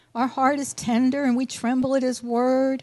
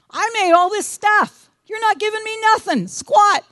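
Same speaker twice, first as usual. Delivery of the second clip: higher, louder indignant God voice; God voice, higher and louder, scoffing; voicing God, indignant; 9 semitones higher, 6 dB louder than usual. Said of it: "So what he's saying is".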